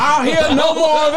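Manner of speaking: high, loud, stammering judge voice; pompous judge thundering from the bench; blustering judge. Acted. Mock trial, judge ruling.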